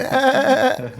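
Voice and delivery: mock high yelp. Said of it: "You went".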